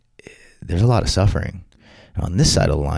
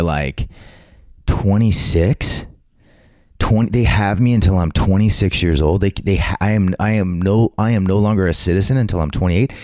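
ordinary talk, nothing marked

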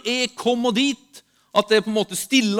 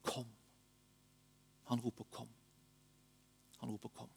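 low, soft and unhurried, fading to very quiet; tender reassurance; 8 semitones lower, 5 dB quieter than usual. Real, not acted. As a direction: offer comfort gently, hushing toward the end. Tender account of grace and invitation.

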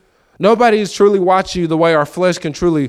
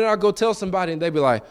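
ordinary delivery

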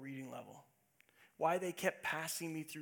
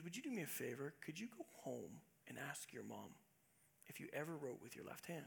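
hushed teacher; 3 semitones lower, 10 dB quieter than usual. Retold or imitated. Teacher's hushed question retold.

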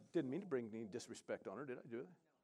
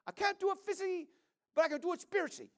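put-on much higher, louder voice of the woman in her accent; imitating the woman; 7 dB louder, faster than usual. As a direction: lift into her high, earnest voice. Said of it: "And she said".

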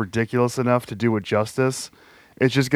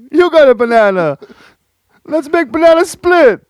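Pat Patterson=48; 14 semitones higher, 5 dB louder than usual. Retold or imitated. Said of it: "Pat Patterson".

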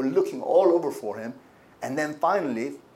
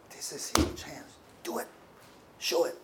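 whispered urging; 7 dB quieter than usual. Retold or imitated. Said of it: "She was so proud of her son".

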